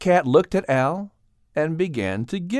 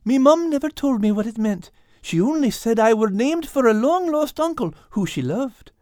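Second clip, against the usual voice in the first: high, humble Irish tomcat; 7 semitones higher than usual. Acted. Al telling of his long-lost uncle, humble high Irish voice. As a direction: explain meekly in a high Irish voice. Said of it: "replied a humble Al".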